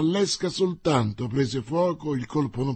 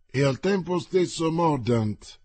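ordinary delivery, nothing marked

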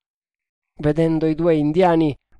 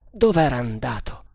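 ordinary delivery, nothing marked

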